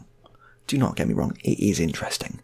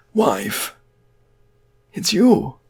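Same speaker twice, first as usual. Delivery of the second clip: returned dead man; 9 semitones higher, 6 dB louder, much slower than usual. Acted. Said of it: "that is what he's speaking like".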